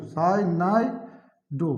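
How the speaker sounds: साइनाइडो very slow, pause before the last syllable; drawn-out term. Shown as a demonstration. Teacher repeating the ligand name for students, slowly drawn out. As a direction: stretch साइनाइडो, each syllable apart. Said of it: "इधर क्या हो जाएगा?".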